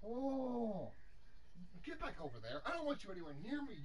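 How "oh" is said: usual speaking voice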